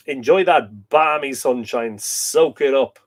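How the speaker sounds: drawn-out urging. Acted. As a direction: stretch each word, savouring it. Urging viewer to enjoy sunshine.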